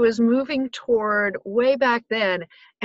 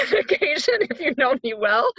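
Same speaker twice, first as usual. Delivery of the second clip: amused, talking through a laugh; 6 semitones higher than usual. Real, not acted. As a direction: laugh your way through the admission. Jokes about own swearing.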